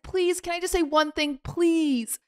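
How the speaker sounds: high, sweet fake plea; mock-polite pleading. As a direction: ask with exaggerated politeness. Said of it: "I'm being very condescending".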